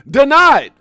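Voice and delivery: loud, high-pitched shouted "Denied"; mock judge ruling. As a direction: bellow the verdict like a judge.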